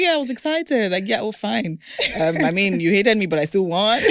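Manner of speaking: high, playful pretend gloating; mock triumph. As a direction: play the winner, brushing off the haters. Host models a happy winner's reply.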